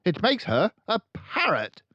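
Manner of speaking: loud, high barking headmistress; booming tyrant headmistress; barking Trunchbull. A narrator's reading. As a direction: bark it out like a bully. Trunchbull rants.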